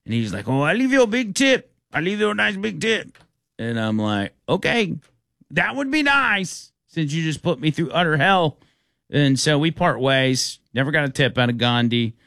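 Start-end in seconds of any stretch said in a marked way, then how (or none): 0.5-3.2 put-on high, generous drunk accent; high Indian accent. Drunk Gandhi promising tip. 4.5-8.6 sarcastic. Retold or imitated. Sarcastic reply to Gandhi.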